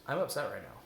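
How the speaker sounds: composed, non-judgmental statement; calm, neutral voice. Shown as a demonstration. Coach contrasting with self-judgment, calm and neutral. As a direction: say it evenly, simply accepting it. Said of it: "Remember that calm, neutral voice?".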